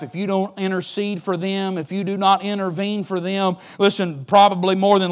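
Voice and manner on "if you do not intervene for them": normal talking voice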